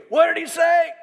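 put-on loud, high shouted question like a deaf old man; hammed-up deaf old man yelling across the table; deaf man's shout. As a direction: bellow it, he can't hear a thing. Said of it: "Baze was basically deaf and loud".

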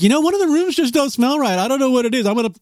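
squeaky clueless-guy impression; mock a puzzled friend, high. Imitating friend, mocking.

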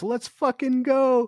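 high, drawn-out hype yell; mock scream. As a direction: stretch it into a high rallying cry. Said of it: "I just kept screaming that".

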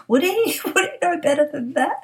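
high, disbelieving squeak, laughing through it; mock incredulous. Told Joe the boss wouldn't, replies with high laughing disbelief. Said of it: "I'm there".

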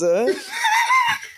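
big high shrieking laugh, real. Real, not acted. Bursts out laughing at teasing.

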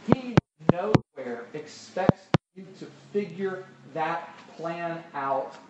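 ordinary talk

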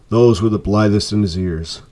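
plain reading voice

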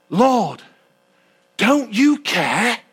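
slow, drawn-out plea of an overworked sister; complaining Martha. Acted. Martha reproaching Jesus while serving, slow complaining plea. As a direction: stretch out the complaint to the Lord.

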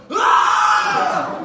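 sudden high yelp of pain; a sharp cry as the light hits the eye; startled shout. Retold or imitated. Teacher hit in eye by laser.

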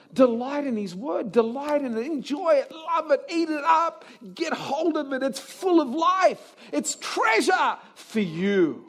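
high, building pulpit cry; fervent exhortation. Real, not acted. Preacher pressing congregation to delight in the word, fervently. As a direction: urge them on with mounting intensity.

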